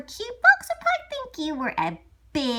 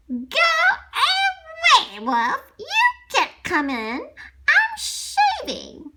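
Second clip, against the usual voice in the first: pig yelling back; 12 semitones higher, 6 dB louder than usual. Acted. Pig yells back from inside.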